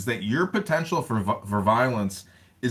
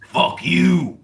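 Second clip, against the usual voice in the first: imitating [name]; 5 semitones higher, 8 dB louder than usual. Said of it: "And he says".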